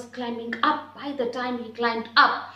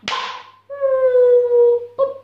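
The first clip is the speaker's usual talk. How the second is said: high loud cartoon "boop"; bump sound effect; 13 semitones higher, 11 dB louder than usual. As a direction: make the crash noise. Bird hits the cliff.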